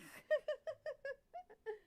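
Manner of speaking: very high squeal of laughter breaking into a cry; helpless shrieking laugh. Real, not acted. Laughing at the trap accident.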